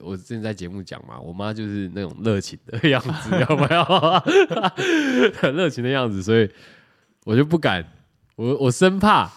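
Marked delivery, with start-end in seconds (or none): 3.9-5.4 high loud burst of real laughter. Real, not acted. Laughing about how warmly enthusiastic mum gets, loud high laughter.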